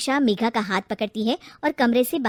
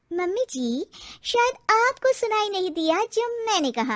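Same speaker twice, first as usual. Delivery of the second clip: higher, sing-song sass thrown back; mock her with her own words; a sly, pitched-up daughter-in-law talking back; cheeky retort; 8 semitones higher than usual.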